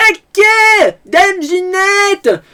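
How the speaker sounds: very high, loud, slow hammy yell; full Jacquouille. Retold or imitated. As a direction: bellow it like an overacting peasant. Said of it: "je le fais gentiment".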